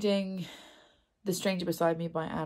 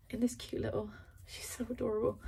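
normal talking voice

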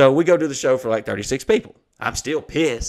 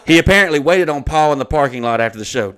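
louder, punched-out official wording; email read-aloud; 7 dB louder than usual. Acted. Venue citing its mask mandate, loudly read aloud. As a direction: declaim the written accusation word by word. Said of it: "I'm gonna read the email".

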